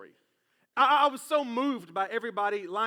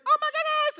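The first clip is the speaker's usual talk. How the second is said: very high, loud imitated squeal; mock freaking out; 8 dB louder than usual. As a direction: squeal like a girlfriend just proposed to. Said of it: "she starts freaking out".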